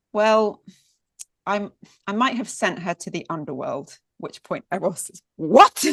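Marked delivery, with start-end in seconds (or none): 0.1-4.0 awkward admission. Acted. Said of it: "looks awkwardly away". 5.4-5.8 high, loud, stunned "What?"; shocked what. Acted. Eros hears his mother sent his wife away, loudly shocked.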